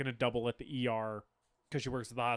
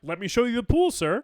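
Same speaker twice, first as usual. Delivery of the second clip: mock polite; 10 semitones higher, 8 dB louder than usual. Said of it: "And he's like".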